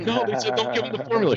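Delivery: very high mock whine; hammed-up tearful protest to mom; imitating crying. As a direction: squeal it out like a wailing new mother. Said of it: "she's crying".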